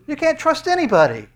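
high, loud cry on can't; loud preaching cry. Acted. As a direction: shout the despair out to the hall. Preacher cries out despair.

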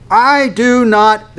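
high, loud, drawn-out refusal; intense denial. Acted. Peter curses and swears, intense denial. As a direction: deny it forcefully, slowly, word by word. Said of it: "will become more intense about his denial".

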